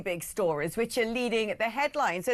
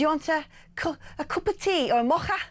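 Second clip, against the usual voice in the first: attempted Scouse accent, pitched higher; 6 semitones higher than usual. Colleague offering the presenter a drink, imitated Scouse. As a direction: imitate a Scouse woman's question, throaty k in "mocha". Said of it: "she's a Scouse".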